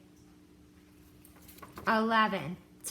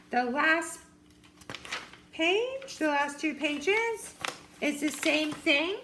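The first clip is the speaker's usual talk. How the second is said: ordinary talk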